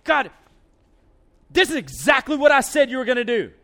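loud, high, indignant outburst; I knew it, said hotly at God; mad at God. Acted. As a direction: prophet venting at God about mercy, angry. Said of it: "He is mad".

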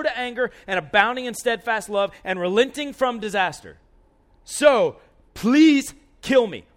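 loud, high, bitter protest; angry complaint to God. Acted. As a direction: hurl it at God like a grievance. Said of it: "how he's saying it".

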